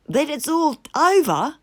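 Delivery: hammed-up despairing Toad; sobbing Toad. A narrator's reading. Toad despairing about losing Toad Hall, sobbing. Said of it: "sobbed Toad".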